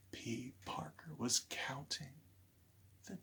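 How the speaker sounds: whispered storytelling hush; suspenseful whisper. A narrator's reading. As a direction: breathe it like a secret to kids.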